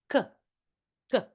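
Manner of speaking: sharp k burst, tongue against the back; k sound. Shown as a demonstration. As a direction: pop the k with a puff of air. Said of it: "if you lift your tongue up against the back here".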